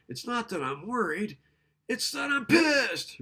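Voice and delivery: loud mock outburst of anger; angry client. Shown as a demonstration. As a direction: play an irritated client snapping back.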